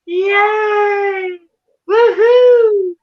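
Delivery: high, chirpy preschool host voice; Lennon impression. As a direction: mimic a sugary kids' show host. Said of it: "it's creepy how I can mimic it, that voice good".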